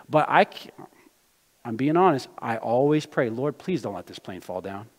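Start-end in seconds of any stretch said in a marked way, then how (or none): none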